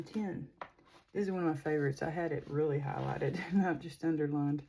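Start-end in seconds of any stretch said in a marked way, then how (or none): none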